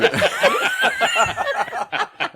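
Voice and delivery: high falling laugh; can't stop laughing; real helpless laugh. Real, not acted. Caught by the joke.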